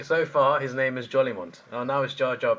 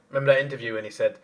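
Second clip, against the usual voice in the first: usual speaking voice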